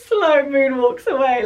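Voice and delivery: loud, cracking up while telling it; laughing. Real, not acted. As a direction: laugh through the words. Cracking up at her own story.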